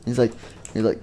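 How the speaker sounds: plain reading voice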